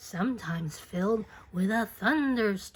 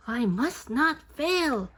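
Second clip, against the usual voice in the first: determined; 6 semitones higher, 4 dB louder than usual. A narrator's reading. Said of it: "said the tiny snail".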